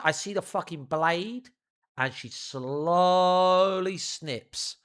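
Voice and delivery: ordinary talk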